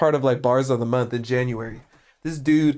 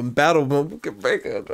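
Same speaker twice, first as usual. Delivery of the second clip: usual speaking voice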